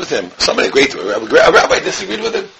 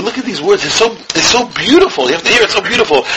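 ordinary talk, nothing marked

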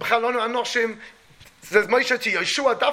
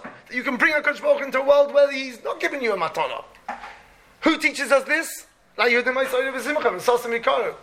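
ordinary talk, nothing marked

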